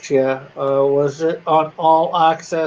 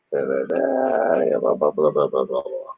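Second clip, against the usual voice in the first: mocking blah-blah; 12 semitones lower, 3 dB quieter than usual. Mocking drone of filler.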